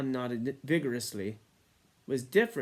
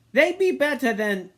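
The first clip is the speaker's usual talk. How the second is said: high, loud and frightened, cut short; a scared boy who can't finish; fearful boy breaking off; 11 semitones higher, 10 dB louder than usual. A narrator's reading. Eric fears the beastie, breaks off scared.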